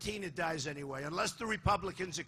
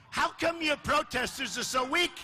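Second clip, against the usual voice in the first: rally taunt; 5 semitones higher than usual. Real, not acted. Taunting crowd about weak protesters.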